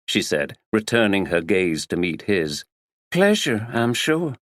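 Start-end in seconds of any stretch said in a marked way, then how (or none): 3.1-4.4 airy American drawl; perky American politeness; American accent. A narrator's reading. Actress Tina greeting the detective, American accent. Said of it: "She spoke in an American accent".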